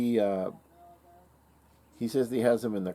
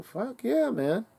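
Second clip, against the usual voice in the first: enthusiastic; 12 semitones higher, much slower than usual. Retold or imitated. Asked if he likes the gun.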